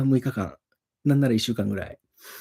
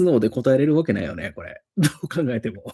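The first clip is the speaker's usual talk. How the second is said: usual speaking voice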